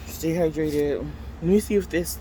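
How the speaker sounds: normal talking voice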